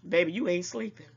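normal talking voice

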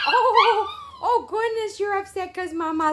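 high, cooing sympathy. Real, not acted. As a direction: coo to an upset puppy in a high voice. Soothing upset puppies in baby talk.

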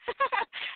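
high, soft burst of laughter; giggling at her own cheek; high giggle. Real, not acted.

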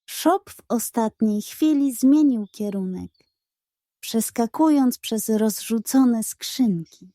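ordinary delivery

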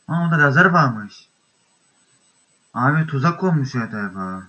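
normal talking voice

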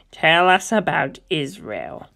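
ordinary talk

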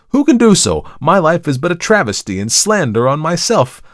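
loud, high, vehement self-defence; impassioned cry. A narrator's reading. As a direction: pour out an anguished justification. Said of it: "cried Markheim".

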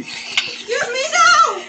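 outraged protest. Real, not acted. Player protests during the attack.

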